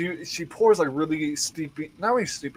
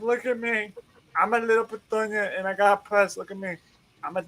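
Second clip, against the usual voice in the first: put-on higher, teasing little-girl voice; playful kid voice; 6 semitones higher, 3 dB quieter than usual. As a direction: play the cute little Petunia in her coat to make her laugh. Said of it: "a quick little joke to make her smile".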